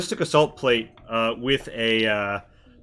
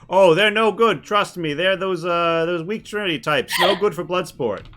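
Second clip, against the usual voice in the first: higher-pitched interjecting character voice; dismissive figure in a raised voice; character from behind; 5 semitones higher, 3 dB louder than usual. Acted. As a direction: cut in high, with a loud start. Unseen character cuts in from behind.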